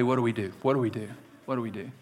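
low and soft, dropping away with each repeat; hushed, fading question. Real, not acted. Preacher asks congregation about feeling that way, hushed and fading. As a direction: let the question sink to almost nothing.

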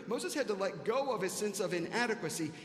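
usual speaking voice

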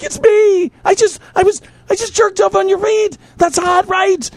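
high, rushed pleading; frantic pleading. Retold or imitated. Man retelling his frantic pleas to screaming girlfriend. Frantic explanation during night terror.

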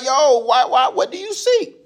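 wary and a bit amused; lightly voiced puzzled Jesus; mock suspicious. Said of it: "Jesus was like".

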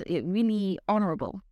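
ordinary talk

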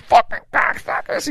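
high, muffled swearing; mouth-full cursing. Acted. Comic cursing with his head in the MC's lapel, muffled. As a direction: curse into a lapel, words smothered. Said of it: "you'd hear his mouth full".

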